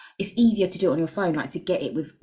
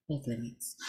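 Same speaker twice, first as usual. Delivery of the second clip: There's limits dropped lower and much softer; quiet, lower murmured repeat of There's limits; 7 semitones lower, 9 dB quieter than usual. Real, not acted. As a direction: let There's limits fall away softly under the breath.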